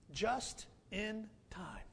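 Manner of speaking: breathy hushed whisper, slow; "just in time" whispered slowly. Acted. Pastor hushing the key phrase to the congregation, whispered slowly.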